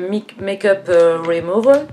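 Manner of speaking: French speaker's go at an English accent; English accent attempt. Real, not acted. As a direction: try English pronunciation on the product name. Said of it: "mon accent anglais, il est balèze". (tentative d'accent anglais)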